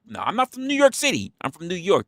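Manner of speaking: high-pitched correction of a fan; mock self-correction. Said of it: "quoting himself correcting them".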